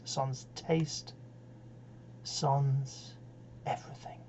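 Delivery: soft, spaced out, dying away; fading into oblivion. Acted. Reciter plays second childishness, fading away quietly. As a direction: let each phrase sink towards near silence. Recites final age of decline.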